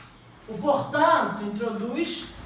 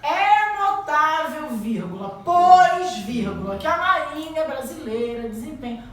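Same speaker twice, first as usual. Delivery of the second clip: slow dictation with "pois" punched very loud; teacher dictating to the class, spelling out each comma and stressing "pois"; 3 semitones higher, 8 dB louder, slower than usual. Shown as a demonstration. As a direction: dictate slowly and hit "pois" hard. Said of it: "Aí eu poderia dizer".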